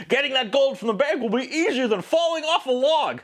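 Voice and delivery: high, hammed-up old-timey prospector; a high, put-on voice for a quaint old-timey line; old-timey quote voice. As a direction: ham it up like a folksy Old West character. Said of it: "loving the old-timey quotes".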